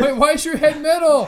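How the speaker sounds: shocked shout. Acted. Cohost baffled by metal head, shouted. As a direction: yell it in disbelief.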